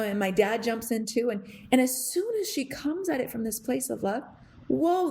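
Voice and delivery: ordinary talk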